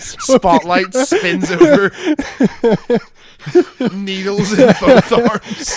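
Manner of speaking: high, loud, slow movie narration; mock dramatic narration. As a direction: narrate like a melodramatic film scene.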